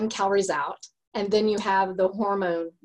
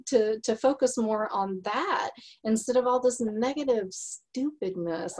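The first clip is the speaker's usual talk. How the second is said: ordinary talk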